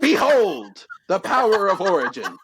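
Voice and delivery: loud, high "behold" flourish; grand proclamation. Acted. Contestant presenting his power to the crowd, grandiose. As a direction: proclaim it to the whole stadium.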